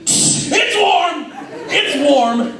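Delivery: high, shouted yelp of pain; burning his hand on the glass pan, shouting. Retold or imitated. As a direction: yelp like you just got burned. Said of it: "I was like".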